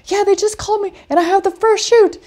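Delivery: high and bright-eyed, imitating Marla's eager reply; excited news. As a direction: Marla telling the speaker about her first shoot, excited. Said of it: "And she's like".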